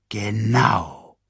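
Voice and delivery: drawn-out villain's reply; Scar. A narrator's reading. Villain confirming his plot to hyenas, drawn out. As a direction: confirm it with relish, falling away. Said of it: "antwortete Scar".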